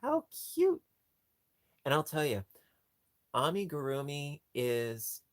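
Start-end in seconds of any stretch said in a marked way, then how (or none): 0.0-0.8 high squeaky coo over something adorable; how cute, squeaked way up high; cutesy squeal. Real, not acted. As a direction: squeal it like you're melting over a tiny toy. Cooing over crocheted animal.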